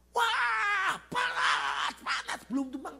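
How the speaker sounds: high drawn-out mock scream; imitated scream. Possessed girl screaming inside the house, high imitated shriek. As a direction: scream it like a scalded demon. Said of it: "anak gadis itu teriak di dalam".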